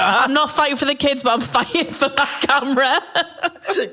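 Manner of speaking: slow, laughing mock dad line. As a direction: laugh through a hammed-up dad.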